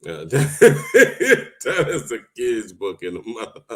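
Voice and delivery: laughing through the words; can't hold back the giggles. Real, not acted. Laughing at the short twelve-page book. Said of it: "Sorry, I don't wanna laugh".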